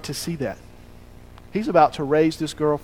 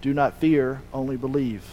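low, hushed reassurance; soft counter to the hopeless voice; quiet reassuring voice; 5 semitones lower, 8 dB quieter than usual. Acted. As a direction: say it softly and low, letting it settle. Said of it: "The other voice says".

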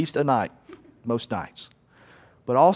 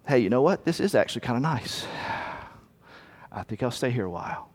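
much softer, lower and relaxed; contented sigh of giving in; 5 semitones lower, 7 dB quieter, faster than usual. Acted. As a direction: settle into comfort and let go. Traveler settles in to rest.

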